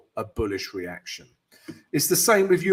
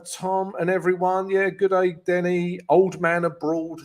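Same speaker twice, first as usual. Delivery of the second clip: normal talking voice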